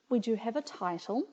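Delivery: normal talking voice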